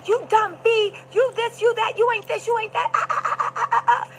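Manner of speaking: high-pitched rapid-fire scolding impression; mock berating. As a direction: rattle off insults high, dissolving into nagging noises. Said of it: "and then berated her".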